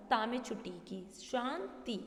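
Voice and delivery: ordinary delivery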